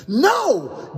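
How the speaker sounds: sharp, high no; firm refusal. Acted. Daniel refuses to hand over the soap, sharp no. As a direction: stop them cold.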